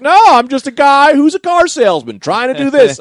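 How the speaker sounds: high, loud insistent correction; exasperated. Retold or imitated. Scene partner correcting the high guy, exasperated. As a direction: set them straight at the top of your pitch.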